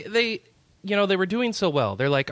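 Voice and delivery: ordinary talk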